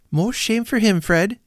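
put-on high, sharp indignant young woman. Niece scolding Fred about his uncle, high indignant. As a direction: scold him, high and pert. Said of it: "said Scrooge's niece indignantly".

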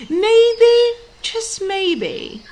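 hopeful teasing. A narrator's reading. Narrator teasing hopeful aside.

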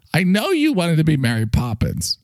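normal talking voice

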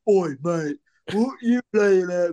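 slow, hammed-up British geezer voice; mock British accent. Shown as a demonstration. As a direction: put on a drawn-out British "oi, mate" accent. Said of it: "I did, like, an American voice. It wasn't like".

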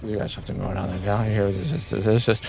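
low, soft, very fast patter; quick, intent talking. Acted. Song reporting into a communicator, quick and intent. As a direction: rattle it off urgently under your voice. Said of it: "talking very quickly, but very intently".

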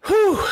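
high, falling whew; big whooped exhale at finishing; relieved whew. Real, not acted.

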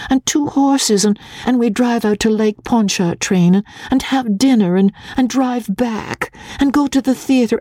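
high, breathless run-on nagging of a daughter; petulant, impatient girl; impatient complaint. A narrator's reading. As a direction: pile up grievances at Papa, pitched high. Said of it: "Magnolia exclaimed impatiently".

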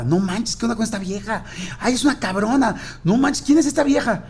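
high, wide-eyed "who is this woman?"; amazed disbelief. Retold or imitated. The editors reading the manuscript, stunned and amazed. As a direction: gasp it out as if stunned by the pages.

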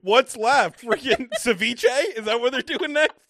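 loud, sarcastic, mocking. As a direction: jeer at the producers.

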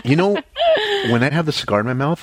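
laughing while talking; giggling through the words. Real, not acted. Laughs at his own lisp.

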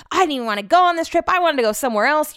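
high, loud, fast petulant whine; whiny complaint. Retold or imitated. Upset on the trip, complaining about Brett, fast high whine. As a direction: sulk it out like a spoiled kid.

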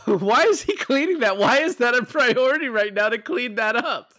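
high, loud exasperated questioning; incredulous outburst. Real, not acted. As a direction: burst out in loud, high disbelief. Laughing mock outrage at scene.

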